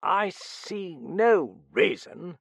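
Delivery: high, loud, very slow objection; indignant protest. A narrator's reading. Man dragged up from London objecting, indignant. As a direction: draw out each word in protest. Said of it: "protested a voice behind him".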